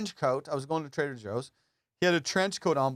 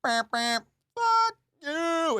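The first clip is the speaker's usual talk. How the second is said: high, louder beep-beep and curse; car horn road rage, mimicked; mock driver yell; 4 dB louder, much slower than usual. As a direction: ham up a honking New Yorker. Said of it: "It's always the".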